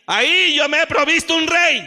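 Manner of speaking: high, loud proclamation; God's command shouted. Acted. Preacher voicing God sending Samuel, proclaiming loudly. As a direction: proclaim it like a decree. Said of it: "Le dijo".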